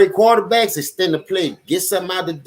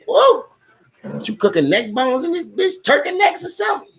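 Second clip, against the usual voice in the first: high, squeaky whoa then a mock complaint; shocked by scalding water; 9 semitones higher than usual. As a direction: yelp as if the tub is boiling. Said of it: "I'm like".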